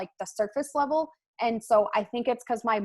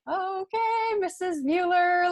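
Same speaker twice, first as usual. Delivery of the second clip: much higher, drawn-out mock kid voice; teasing kids; 9 semitones higher, slower than usual. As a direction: imitate the class sighing at their teacher. Kids react to teacher crying.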